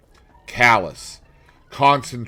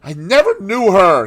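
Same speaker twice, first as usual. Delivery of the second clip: stammering; 11 semitones higher, 11 dB louder than usual. A narrator's reading. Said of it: "he stammered".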